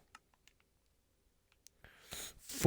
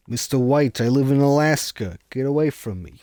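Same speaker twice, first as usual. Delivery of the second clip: bad Jesse impression; 4 semitones higher, 6 dB louder than usual. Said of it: "It sounds nothing like Jesse".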